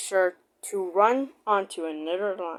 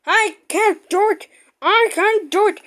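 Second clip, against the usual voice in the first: higher, louder puffing chant; 10 semitones higher, 6 dB louder than usual. Acted. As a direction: pant it out like a straining engine. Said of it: "puffed Gordon".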